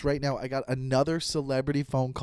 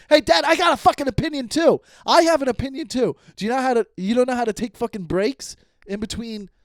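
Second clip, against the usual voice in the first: high, loud, exasperated shout, easing toward the end; acted-out shouting to get a word in; yelling; 8 semitones higher, 6 dB louder than usual. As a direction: yell over a dad who won't stop talking. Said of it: "but I'm yelling".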